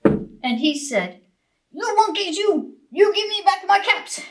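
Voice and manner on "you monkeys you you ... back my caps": higher, insistent scolding; angry peddler. A narrator's reading. Angry peddler demands his caps from the monkeys. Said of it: "Now he felt quite angry".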